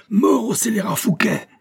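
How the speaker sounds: the king roaring for Fouquet's death. A narrator's reading. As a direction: howl it like a madman past words. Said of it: "hurlait-il".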